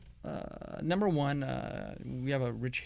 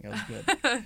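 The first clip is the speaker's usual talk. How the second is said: laughs it out, higher and louder; a warm chuckle as the memory lands; laughing; 12 semitones higher, 8 dB louder than usual. Real, not acted. Fond laugh recalling wedding.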